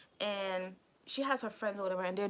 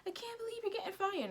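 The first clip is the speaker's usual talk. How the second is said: put-on high girl voice; 11 semitones higher than usual.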